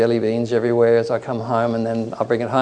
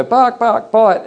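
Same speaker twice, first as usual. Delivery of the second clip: imitated dog barks; 8 semitones higher, 4 dB louder, much slower than usual. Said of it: "and the dogs go".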